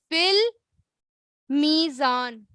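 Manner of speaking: فی المیزان drawn out very slowly, each sound held; very slow repeat. Shown as a demonstration. Teacher repeats the phrase for students to copy, very slowly. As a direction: stretch فی المیزان out for the class to repeat. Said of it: "ٹھیک ہے، کس میں؟".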